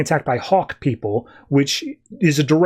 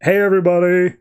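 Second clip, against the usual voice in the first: slightly raised mock intercom voice; intercom announcement; 4 semitones higher than usual. Telos on the intercom to everybody below, announcing. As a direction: call it out like an announcement over a speaker. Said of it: "in comes Telos on the intercom".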